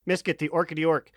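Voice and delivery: usual speaking voice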